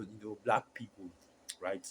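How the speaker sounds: usual speaking voice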